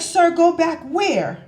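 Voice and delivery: high, loud, drawn-out mock disbelief; retold indignant question, pitched right up; incredulous retort. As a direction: question it slowly, acting out disbelief. Retold disbelief at being sent back.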